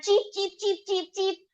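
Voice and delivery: lightly voiced high cheeps of a fleeing chick; chick cheeping. A narrator's reading. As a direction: peep like a frightened chick on the run. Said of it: "crying for help".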